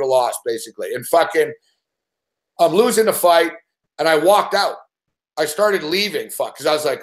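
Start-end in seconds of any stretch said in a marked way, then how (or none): none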